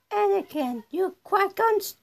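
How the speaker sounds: put-on high small child voice; high character voice. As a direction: pipe it up high. Voicing a Star Wars character.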